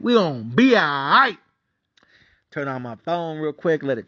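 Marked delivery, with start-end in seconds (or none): 0.0-1.4 a lifted, louder rising reassurance; louder self-assurance. Retold or imitated. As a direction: say it again with more conviction. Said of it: "I'm like".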